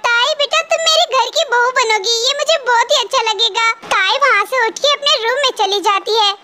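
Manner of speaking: ordinary delivery, nothing marked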